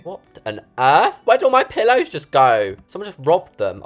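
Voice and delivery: loud, high startled alarm; surprised. Real, not acted. Vlogger finding pillows gone, startled. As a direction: react as if something just vanished.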